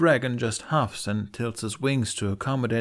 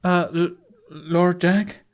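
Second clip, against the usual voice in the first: nervous, higher stammer on "Uh, L-"; flustered, raised stammer; 9 semitones higher, 6 dB louder, slower than usual. Acted. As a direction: trip over "Lord Jack?" shyly. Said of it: "Fishlegs stammers".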